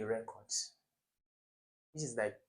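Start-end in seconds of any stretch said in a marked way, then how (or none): 0.0-0.5 "record" stressed on the first syllable, REC-ord. Shown as a demonstration. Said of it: "the first one is a noun".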